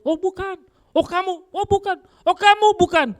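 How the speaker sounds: high, quick nervous denials; acted denial. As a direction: dodge the accusers, voice up high.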